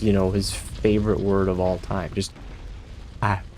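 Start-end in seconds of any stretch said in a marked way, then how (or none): 2.1-3.4 ash stretched and hushed, savored; Gale's ash. Retold or imitated. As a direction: breathe out ash like a favorite word. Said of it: "how Gale pronounces the word ash".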